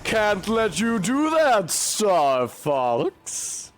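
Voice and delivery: hammed-up taunt from an old game villain; Star Fox villain quote. Gamer quoting a Star Fox villain, lightly mock-voiced. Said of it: "the guy who goes".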